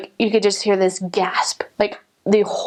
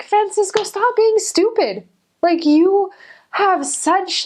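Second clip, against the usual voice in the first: much higher, pushy and exasperated; exasperated scolding; 11 semitones higher, much slower than usual. Retold or imitated. Missionary scolding a man who won't quit drinking, exasperated. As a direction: scold him like a fed-up big sister.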